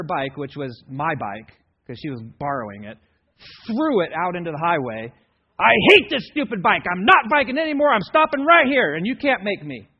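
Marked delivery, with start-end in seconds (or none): 5.6-9.9 put-on loud, high, furious child's tantrum; angry yelling. Daughter yelling at dad on the road, loud and furious. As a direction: scream it out like a kid refusing to go on. Girl throws bike into highway.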